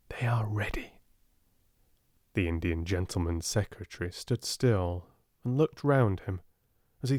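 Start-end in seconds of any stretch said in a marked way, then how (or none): 0.1-0.9 breathy voiceless whisper. A narrator's reading. Ram Dass telling the secretary, in a whisper. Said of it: "felt their movements and whispers ominous".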